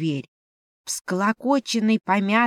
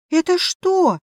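high, loud astonished question; startled cry; 11 semitones higher, 5 dB louder than usual. A narrator's reading. Heroine finds the ring will not budge, startled cry. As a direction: cry out before you can help it. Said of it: "невольно вскрикнула я".

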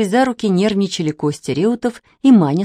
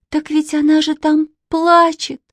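put-on high, pitying little-girl voice; piteous high-pitched appeal; plaintive; 9 semitones higher than usual. A narrator's reading. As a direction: plead on her behalf, voice held high. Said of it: "жалобно сказала Катя".

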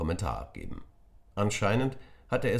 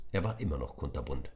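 usual speaking voice

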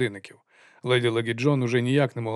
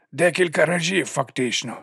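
higher-pitched lisping henchman, adding a point; lisping servant; 4 semitones higher than usual. A narrator's reading. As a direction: lisp it like an odd servant.

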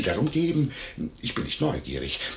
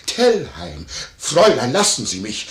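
loud, dramatic imitation of a quarrel; retold urgent cries; 6 dB louder than usual. Innkeeper re-enacts the lady and Major struggling, mock-urgent cries. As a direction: re-enact the outcry loudly.

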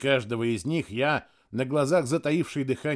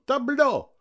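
repeated high call, falling; shout; 11 semitones higher than usual. A narrator's reading. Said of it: "крикнул он". (выкрик со спадом)

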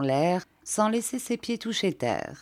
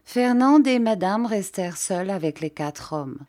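usual speaking voice

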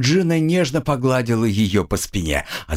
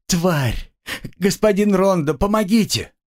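desperate call for help; 9 semitones higher than usual. A narrator's reading. Girl calls for help.

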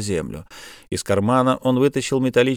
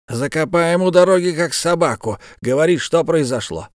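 angry threat; 6 semitones higher than usual. Acted. Mayor threatens the bandit.

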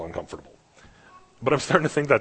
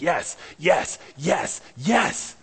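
high, building yeses; each yes pops higher, like a heart catching fire; rising, stirred-up yes; fired-up affirmation; 6 semitones higher, much slower than usual. Retold or imitated.

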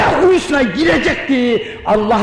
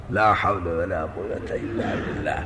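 low laughing murmur; 12 dB quieter, faster than usual. Real, not acted. Preacher murmurs the formula, laughing.

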